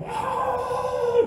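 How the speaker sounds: put-on a sudden high scream from the possessed boy. Preacher acting out the boy's scream as smoke leaves. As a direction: let out one sharp scream as the spirit leaves. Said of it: "tiba-tiba dia teriak".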